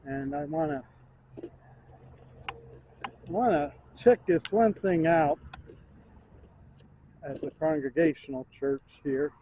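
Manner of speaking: ordinary talk, nothing marked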